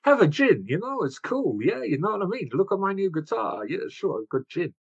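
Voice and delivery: high, hammed-up Dutch accent, easing down at the end; bad Dutch accent. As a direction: play a cool dude with a badly faked Dutch accent. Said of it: "I can't do a Dutch accent".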